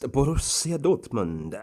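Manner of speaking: team name drawn out slowly with an Italian flourish; Italian-like accent. Real, not acted. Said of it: "said it like an Italian".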